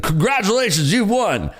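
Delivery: high, loud cheesy ad announcer; mock a nineties pop-up ad; hammed-up prize announcement. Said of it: "You remember those pop-ups?".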